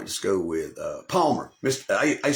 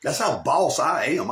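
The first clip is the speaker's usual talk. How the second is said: ordinary delivery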